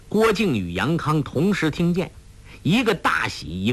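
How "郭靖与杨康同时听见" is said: ordinary delivery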